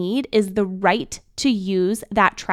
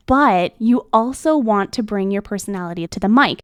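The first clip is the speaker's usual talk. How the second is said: ordinary talk, nothing marked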